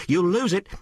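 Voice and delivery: high-pitched mock call of warning; retold call. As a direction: sing it out as a quoted warning. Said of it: "she sings out".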